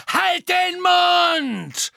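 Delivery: very high, loud, drawn-out screech; furious shriek. A narrator's reading. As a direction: shriek it to silence him. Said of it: "kreischte Bellatrix".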